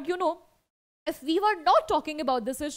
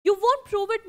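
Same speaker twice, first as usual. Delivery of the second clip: higher and louder, a biting comeback; sharp retort; 7 semitones higher, 5 dB louder than usual. Acted. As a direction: snap it back at him. Acted quarrel, retort.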